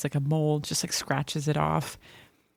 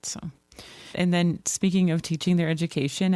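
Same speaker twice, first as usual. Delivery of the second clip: ordinary talk, nothing marked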